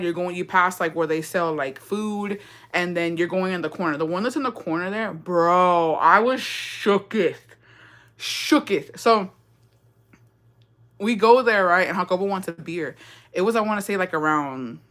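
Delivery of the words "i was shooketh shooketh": drawn-out shooketh. Pretend. Dramatic slang emphasis.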